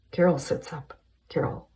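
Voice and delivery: ordinary delivery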